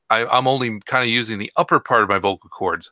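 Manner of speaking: usual speaking voice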